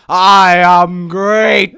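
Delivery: very slow, loud intoned declaration; epic intonation. Acted. Addict answering "are you okay", loud epic intoning. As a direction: drag it out in booming intonation. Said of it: "you were gonna quit the intonation".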